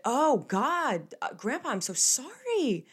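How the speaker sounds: high, pleading mock sorry; exaggerated apology. Granddaughter apologising to grandpa, retold exaggerated contrition.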